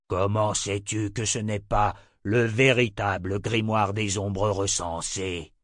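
slow, suspicious tyrant voice; deliberate, heavy villain questioning; questioning emperor. Acted. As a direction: interrogate with drawn-out words.

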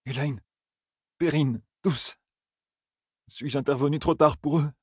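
dreading the answer; anxious Rand. A narrator's reading. Rand asks after friends.

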